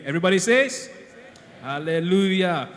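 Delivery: loud, drawn-out hallelujah call; rally the church to answer. Real, not acted. Calling congregation to respond.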